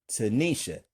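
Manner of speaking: ordinary talk, nothing marked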